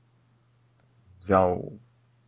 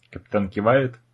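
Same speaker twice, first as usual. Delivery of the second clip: ordinary talk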